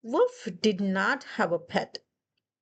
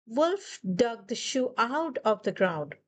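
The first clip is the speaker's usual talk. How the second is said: usual speaking voice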